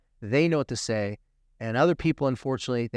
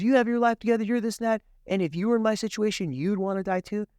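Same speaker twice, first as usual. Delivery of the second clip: put-on girl pleading; 8 semitones higher than usual. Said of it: "she goes".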